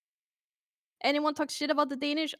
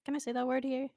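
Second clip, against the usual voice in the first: ordinary talk, nothing marked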